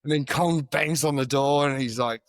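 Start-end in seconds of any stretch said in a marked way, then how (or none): none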